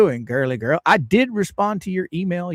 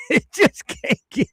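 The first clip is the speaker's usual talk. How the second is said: laughing while talking. Real, not acted. Host laughing over the latest gossip.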